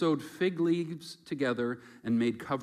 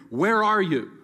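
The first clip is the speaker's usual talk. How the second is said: much louder and higher, accusing; loud stern where are you; harsh demanding call; 7 semitones higher, 8 dB louder than usual. Shown as a demonstration. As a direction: bark it out like an angry judge. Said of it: "he's not saying".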